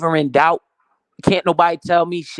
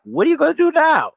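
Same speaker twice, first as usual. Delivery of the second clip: much higher, louder imitation of her; mock shrill girlfriend; 13 semitones higher, 4 dB louder than usual. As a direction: do her alarmed high voice. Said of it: "She was like".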